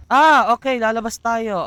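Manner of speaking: high, loud startled exclamation; surprised. Real, not acted. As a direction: jump at something onscreen. Sudden reaction in game.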